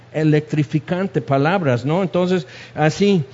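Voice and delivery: ordinary talk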